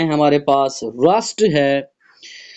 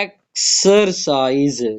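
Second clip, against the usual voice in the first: Exercise slowly as ek, ser, size; syllable by syllable; 3 semitones lower, much slower than usual. Shown as a demonstration. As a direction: gaps between the parts of exercise. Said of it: "ये three syllable है".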